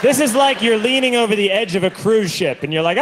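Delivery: ordinary talk, nothing marked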